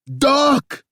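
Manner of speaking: high loud holler. A narrator's reading. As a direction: holler it out in alarm. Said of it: "I hollered".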